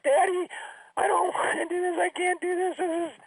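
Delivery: very high, whiny mock sobbing; imitated crying. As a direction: plead to Daddy in a squeaky put-on cry. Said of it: "and she's crying".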